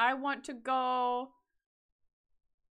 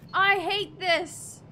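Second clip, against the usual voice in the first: loud, slow, frustrated cry; exasperated outburst; 6 semitones higher, 5 dB louder, slower than usual. Real, not acted. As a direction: let the dread burst out loud. Dread building to outburst.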